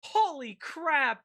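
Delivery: loud, high, slow shocked yell; shocked shout. Real, not acted. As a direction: blurt it out in shock. Shocked by voice changer.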